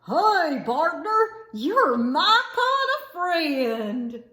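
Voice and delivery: hearty, drawn-out cowboy holler; shouting. Acted. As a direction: cheer on your new partner. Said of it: "and shouted".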